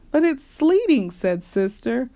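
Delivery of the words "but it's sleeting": high-pitched whiny objection; child's objection. A narrator's reading. Sister objecting to Papa's walk, high whine. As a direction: raise the pitch in complaint.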